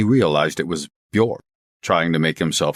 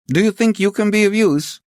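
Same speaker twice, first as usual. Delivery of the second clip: high, raised phone voice; police chief; 5 dB louder than usual. Acted. Chief asking the inspector if he is useful, high raised voice. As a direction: press the question down the line.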